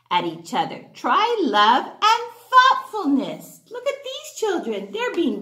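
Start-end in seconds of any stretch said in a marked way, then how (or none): none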